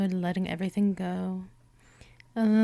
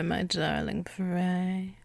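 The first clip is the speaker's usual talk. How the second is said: ordinary talk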